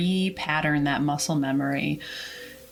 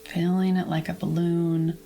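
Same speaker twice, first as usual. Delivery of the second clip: calm, measured meditation voice; guided relaxation. Acted. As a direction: guide listeners gently through releasing tension, with long pauses. Leads whole body relaxation.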